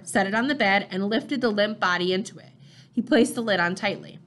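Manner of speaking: ordinary delivery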